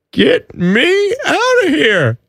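panicked plea. Retold or imitated. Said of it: "now it's just".

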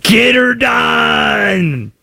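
very slow, drawn-out Southern shout of Get her done; Southern accent. Retold or imitated. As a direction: belt Get her done like a good ol' boy. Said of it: "It's always the Southern accent".

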